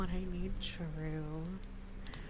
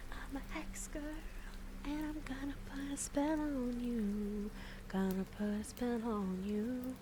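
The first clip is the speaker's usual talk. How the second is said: higher witchy singsong; spooky singsong; 6 semitones higher than usual. Pretend. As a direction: sing it like a witch. Playing a Hex Girl.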